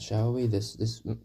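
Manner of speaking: ordinary talk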